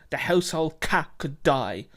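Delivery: ordinary delivery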